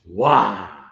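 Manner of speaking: normal talking voice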